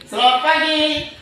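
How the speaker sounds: "Selamat pagi" called out high and loud; called greeting. Shown as a demonstration. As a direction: sing out the greeting to the class. (salam "Selamat pagi" diserukan tinggi dan keras)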